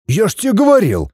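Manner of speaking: high-pitched, excited reproach; agitated. A narrator's reading. Son reproaching his father for not listening, agitated. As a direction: insist with soaring pitch. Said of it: "возбуждённо заявил Святослав".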